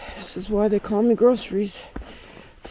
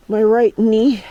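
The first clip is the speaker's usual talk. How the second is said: ordinary delivery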